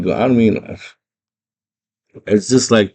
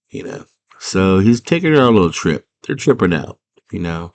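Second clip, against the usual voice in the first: ordinary delivery, nothing marked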